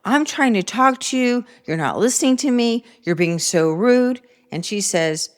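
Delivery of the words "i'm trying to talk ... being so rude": higher-pitched, imitating her upset self; mock upset scolding, slowing on the last line; retold upset outburst. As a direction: replay the moment she snapped at her daughter. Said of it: "I got so upset".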